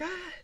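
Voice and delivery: short high-pitched mock shriek; mock scream. Shown as a demonstration. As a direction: demonstrate a scream, held back. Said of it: "I'm gonna start screaming like".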